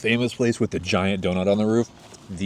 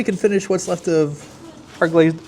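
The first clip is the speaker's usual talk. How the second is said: laughing; 13 semitones higher, 3 dB louder, slower than usual. Real, not acted. Laughing while grabbing the donut.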